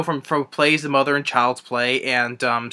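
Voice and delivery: ordinary talk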